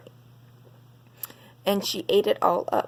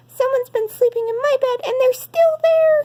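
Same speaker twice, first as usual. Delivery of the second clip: put-on very high, loud baby bear cry rising louder; cried; 6 dB louder than usual. Baby Bear finds Goldilocks in his bed, very high loud alarmed cry. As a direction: squeal it out, the cub alarmed at the intruder. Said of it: "he cried".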